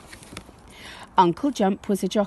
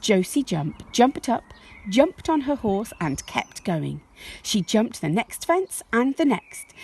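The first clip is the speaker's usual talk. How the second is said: ordinary delivery, nothing marked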